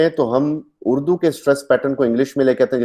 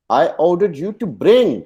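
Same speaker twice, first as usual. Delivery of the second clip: slow, "bring" stretched high, then falling; stress on BRING; 6 semitones higher, much slower than usual. Shown as a demonstration. Teacher shows the action wanted, stress on bring. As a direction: land hard on "bring". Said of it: "लेके आना है".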